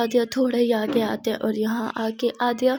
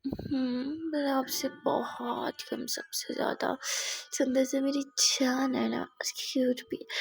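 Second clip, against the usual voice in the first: whisper; 8 dB quieter, slower than usual. A narrator's reading. Whispering sweet talk.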